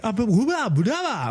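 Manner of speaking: mock freak-out. People panicking over a kitchen fire, mock panic. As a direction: imitate people jabbering in alarm. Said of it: "people are freaking out".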